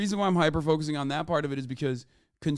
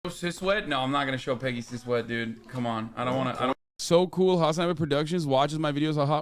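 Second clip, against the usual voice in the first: ordinary talk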